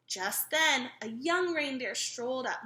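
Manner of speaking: usual speaking voice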